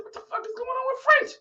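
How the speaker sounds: high incredulous mock outrage; squeaky disbelief. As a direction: yelp the question in disbelief. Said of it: "I said".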